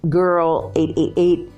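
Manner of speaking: ordinary talk, nothing marked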